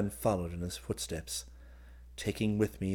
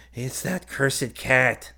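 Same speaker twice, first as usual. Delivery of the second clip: slow, raised, strained character voice; groaning injured man; 5 semitones higher, 6 dB louder, much slower than usual. A narrator's reading. Wounded man blaming the cat to visitor, pained. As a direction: complain slowly and loudly through the pain. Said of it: "ceasing his groans".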